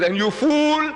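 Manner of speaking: scornful retort. Acted. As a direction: turn the insult around in triumph. Rais throws it back.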